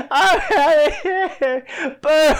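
high, loud words swallowed by laughing; helpless laughter, unintelligible. Real, not acted. Co-host unable to finish the story, laughing too hard. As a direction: try to talk while laughing to tears. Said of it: "I can't hear what you're saying".

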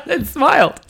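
high-pitched, sugary-sweet cheer; retold cheery smile; smiley greeting. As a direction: greet them with a big audible smile. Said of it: "I smiled and I went".

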